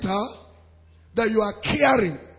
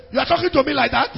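quick, higher mock indignation; quarrelling retort; 5 semitones higher, 4 dB louder, faster than usual. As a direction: fire back as an offended spouse, play-acted. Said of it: "this one, they say".

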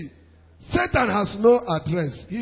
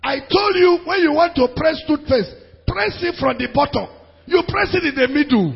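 loud, higher-pitched mock scolding; acted quarrel; 6 semitones higher, 5 dB louder than usual. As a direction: scold a spouse over a petty mistake, hammed up. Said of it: "they were fighting".

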